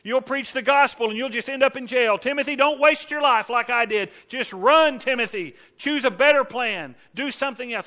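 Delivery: loud, high, urgent pleading; mock frantic warning. Paul imagined urging Timothy to flee, loud and frantic. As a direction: shout a panicked warning to run. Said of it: "That's not the letter he writes".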